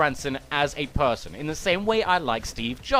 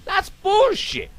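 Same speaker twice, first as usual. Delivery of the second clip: exasperated outburst; 12 semitones higher, much slower than usual. Real, not acted. Snaps out of the parody.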